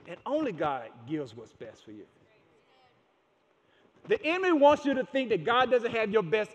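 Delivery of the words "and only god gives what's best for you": low soft emphasis. Real, not acted. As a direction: drop low and soft on the refrain. Sermon refrain, dropped low.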